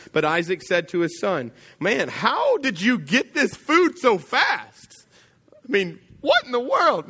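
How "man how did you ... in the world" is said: slow, much higher, put-on voice of a baffled father; amazed old father. Blind father amazed by the fast meal, slow high voice. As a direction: stretch out the old man's disbelief.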